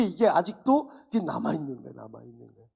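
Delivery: ordinary delivery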